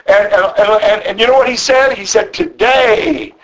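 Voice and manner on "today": exclamation. Acted. Yeshua's promise, repeated word.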